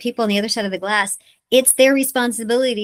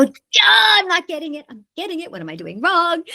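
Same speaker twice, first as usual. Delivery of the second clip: high, pleading mock whine of a struggling actor; whiny self-doubt; 8 semitones higher than usual.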